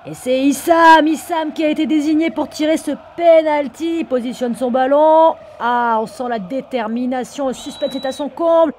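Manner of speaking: high, loud goal call; frantic mock sports commentary. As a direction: scream the goal like live TV. Live call of the penalty.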